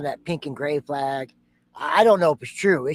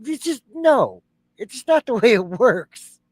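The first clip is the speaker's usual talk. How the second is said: exasperated scoff; 7 semitones higher, 4 dB louder than usual. Real, not acted. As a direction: throw it out as nonsense. Host scoffs at the story.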